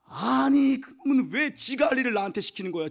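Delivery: high, loud indignant outburst; mock angry rant. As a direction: vent at the lazy employee. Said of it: "열받은 거야".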